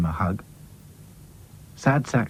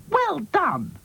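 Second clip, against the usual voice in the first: high, bright little cheer. Acted. Dotty cheering Hi-Fi's long jump, high cheer. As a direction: call it out in delight.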